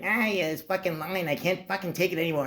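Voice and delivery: high, thin cartoon sponge voice, grumbling; high squeaky cartoon voice bitching about the line; SpongeBob voice. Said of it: "he does the same voice as SpongeBob".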